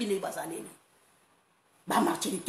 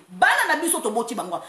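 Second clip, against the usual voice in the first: ordinary talk, nothing marked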